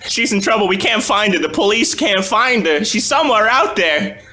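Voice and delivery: high desperate pleading; mother begging for her missing daughter; distressed mother. Acted.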